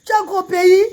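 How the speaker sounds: high pleading call to the queen, a touch hammed-up; mock pleading mourner. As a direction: beg her to come to the funeral. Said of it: "la reine Galoise". (supplication exagérée, aiguë)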